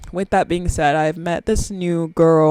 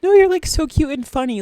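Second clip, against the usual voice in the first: higher, lightly voiced young coworker; imitating coworker; 9 semitones higher than usual. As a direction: mimic her gushing tone. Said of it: "she was like".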